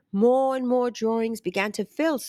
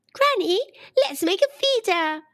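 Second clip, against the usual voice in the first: bright, higher voice calling out to granny; excited girl; 8 semitones higher than usual. A narrator's reading. Girl eagerly suggesting a feeder to granny, excited and high. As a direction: pitch it up with eager delight. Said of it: "Sophia giggled happily".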